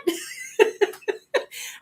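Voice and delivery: a short airy laugh up high; high breathy laugh. Real, not acted. Laughing about hiding her drinking, airy high laugh. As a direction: laugh at your own story. Laughs after retold line.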